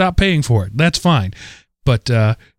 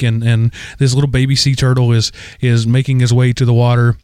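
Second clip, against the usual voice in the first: ordinary talk, nothing marked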